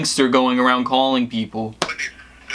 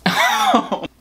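burst of laughter. Real, not acted. Caller cracks up after hanging up, real laugh.

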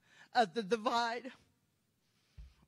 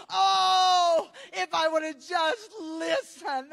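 wailing regret; 5 semitones higher, 8 dB louder than usual. Retold or imitated. Preacher voicing loved ones screaming in hell, wailing regret. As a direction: cry it out as a soul full of regret. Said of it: "I heard them scream ... saying".

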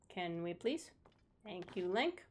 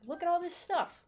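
excited; 10 semitones higher than usual. Real, not acted. Discovers unexplored area.

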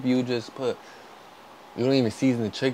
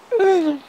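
high squealing burst of laughter; shrieking laugh; 9 dB louder than usual. Real, not acted. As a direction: let out a sudden shrill laugh. Bursts out laughing at own joke.

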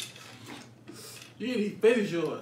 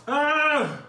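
pained gasp; 7 semitones higher, 6 dB louder than usual. Real, not acted. Reacting to burning heat.

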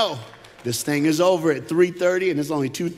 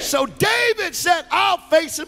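much higher, slow fervent shout; preached climax; 12 semitones higher, 4 dB louder, slower than usual. Acted. Preacher voicing David's resolve at full force, a high shout.

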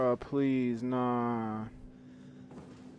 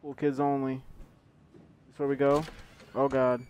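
normal talking voice

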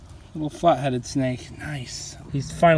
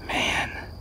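excited wildlife host whisper; 5 dB quieter than usual. Real, not acted. Awed by snake.

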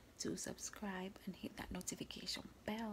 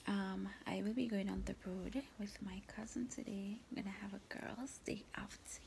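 whispering. Real, not acted. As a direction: whisper so nobody wakes. Whispers all through the vlog.

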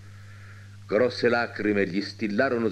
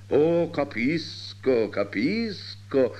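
slow, drawn-out, higher-pitched delight; blissful doctor; 5 semitones higher, much slower than usual. A narrator's reading. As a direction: stretch each word out in beatific understanding. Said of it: "rispondeva il medico con la beatitudine". (medico beato, parole strascicate)

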